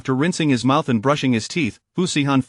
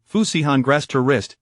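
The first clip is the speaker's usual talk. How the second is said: ordinary delivery, nothing marked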